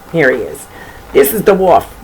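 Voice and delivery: usual speaking voice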